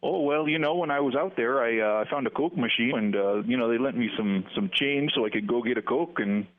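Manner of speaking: folksy Minnesotan demo with long O's; best Minnesota accent. Pretend. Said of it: "give us your best Minnesota accent".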